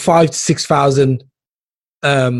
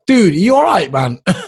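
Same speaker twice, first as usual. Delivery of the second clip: mock pleading; 12 semitones higher than usual. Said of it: "And I'm like".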